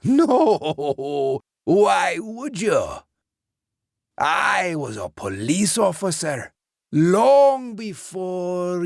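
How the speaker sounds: an ancient lawman boasting and sneering; boastful old ghost. A narrator's reading. Dead policeman lectures beaten Lance, boastful and cruel.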